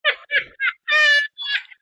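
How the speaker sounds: high, loud squeal of laughter; real high cackle; laughing. Real, not acted. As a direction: crack up in a high-pitched peal. Laughing at the Oscar joke.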